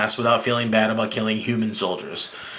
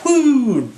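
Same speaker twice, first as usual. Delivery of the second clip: mock whoop; 10 semitones higher, 4 dB louder than usual. Sound of diving off.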